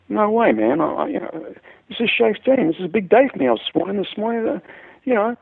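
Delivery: a keyed-up guitarist begging to keep his big day; excited protest. Retold or imitated.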